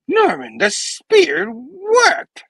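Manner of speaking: high, loud, drawn-out character voice; exaggerated high-pitched announcement; hammed-up exclamation. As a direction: stretch it out in a high theatrical cry. First take as Peter, then redone.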